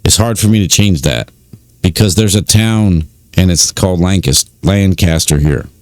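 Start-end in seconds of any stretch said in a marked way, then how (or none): 4.6-5.4 Lancaster corrected to LAN-caster; standard pronunciation. Shown as a demonstration. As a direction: pronounce Lancaster as spelt, LAN-CAST-er. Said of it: "you would pronounce it".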